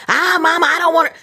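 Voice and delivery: lightly voiced son waving off his mama; son brushing her off. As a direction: mimic a son cutting mom off. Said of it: "he's gonna cut your ass short".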